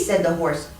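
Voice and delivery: plain reading voice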